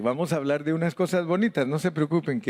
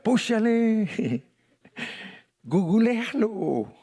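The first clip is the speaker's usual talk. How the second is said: espanglish muy agudo y arrastrado, con risita; imitando espanglish mexicano; 9 semitones higher, much slower than usual. Retold or imitated. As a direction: alarga cada palabra burlándote del espanglish. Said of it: "que todo lo hacemos spanglish". (parodia cantadita del espanglish mexicano)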